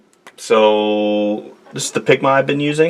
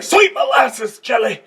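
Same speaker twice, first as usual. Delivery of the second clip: mock startled exclamation. Artist spooked by his screensaver, cartoony high yelp. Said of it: "I'm like".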